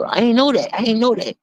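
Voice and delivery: high, whiny mock denial; mock innocent high-pitched whine; mocking Chad. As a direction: play dumb in a high put-on voice. Mocking Chad's excuse.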